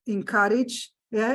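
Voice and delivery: ordinary delivery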